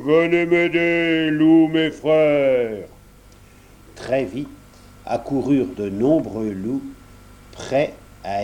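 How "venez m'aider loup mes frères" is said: loud, slow call to the pack; wolf summoning the pack, loud and drawn out. A narrator's reading. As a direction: call your brothers from afar. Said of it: "il se mit à hurler".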